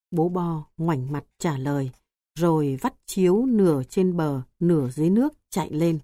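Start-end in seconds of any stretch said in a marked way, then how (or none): none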